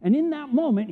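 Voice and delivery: normal talking voice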